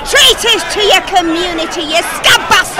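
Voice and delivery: angry shout. Acted. Yelling at scab van.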